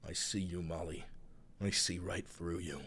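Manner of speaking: low, soft knowing voice; sly low tease. Real, not acted. Streamer teasing Molly, low and knowing. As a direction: murmur it like you caught her.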